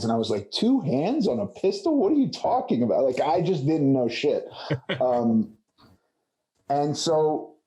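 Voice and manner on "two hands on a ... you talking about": high, fast, baffled retort; incredulous disbelief. Retold or imitated. Actor retelling his reply to the gun instructor, incredulous. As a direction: act like the idea is absurd.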